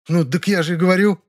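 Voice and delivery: high-pitched, indignant country woman; indignant jabber. A narrator's reading. Nyura explains herself to the narrator, indignant. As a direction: protest in a high, agitated voice. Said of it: "возмущённо опять затараторила она".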